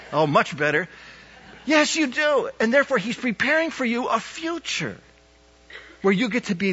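rising, ringing pulpit voice; impassioned sermon delivery; preaching at full force. Acted. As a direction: build it up and preach hard.

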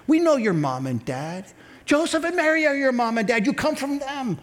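high-pitched griping, mimicking the crowd; mock grumbling. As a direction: complain like an indignant grumbler. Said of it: "they're grumbling underneath their breath".

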